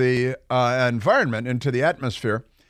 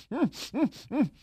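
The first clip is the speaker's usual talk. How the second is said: Pepe Le Pew hum; 11 semitones higher, 8 dB quieter, slower than usual. Retold or imitated.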